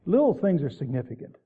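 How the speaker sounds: Little leaned on, then falling away; weight on Little, rest of the line lighter; stress on little. Shown as a demonstration. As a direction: hit the word Little hard. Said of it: "Emphasis on the word little".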